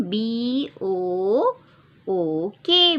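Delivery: letters drawn out; slow spelling out. Shown as a demonstration. Teacher spelling book slowly for children. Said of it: "तो क्या आएगा spelling".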